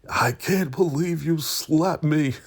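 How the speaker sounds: high, slow disbelieving imitation; mock outrage. Retold outrage after slap.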